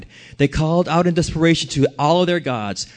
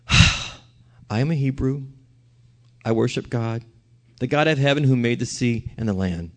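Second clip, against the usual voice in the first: resigned confession; 6 semitones lower, 6 dB quieter than usual. A narrator's reading. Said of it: "He told them, sighs".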